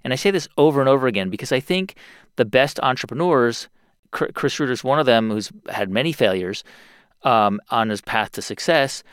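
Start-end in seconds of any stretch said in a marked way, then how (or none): none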